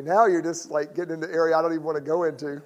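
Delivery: ordinary delivery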